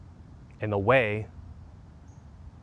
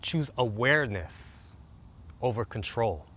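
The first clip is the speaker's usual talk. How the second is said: guided meditation. Acted. Coach leading listeners through a calming routine, soothing meditation voice. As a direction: guide them gently into the body.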